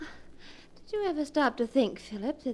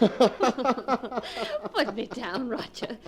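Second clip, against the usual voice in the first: indignant demand; much slower than usual. Acted. Supposed corpse speaks.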